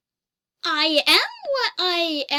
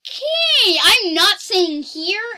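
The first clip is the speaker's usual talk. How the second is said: surprised; 5 semitones higher, 9 dB louder than usual. Real, not acted. Reacts to a wrong transcription.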